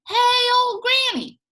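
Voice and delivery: high, loud calling snake. Acted. Water snake calling out to Granny, high and loud. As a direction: sing out high like a sly snake. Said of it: "it said".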